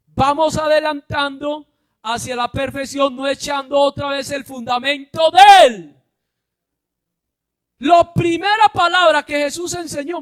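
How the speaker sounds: loud, high preacher's shout; preaching at full force. Acted. Preacher shouting the point to his congregation. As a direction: drive it home to the congregation.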